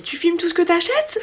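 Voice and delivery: put-on high, light child's question; little niece voice. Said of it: "elle me dit". (imitation d'une petite nièce, voix aiguë)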